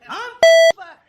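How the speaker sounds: high, loud stunned cry; loud disbelief. Retold or imitated. Fired worker cries out, stunned and loud. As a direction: wail it in shock.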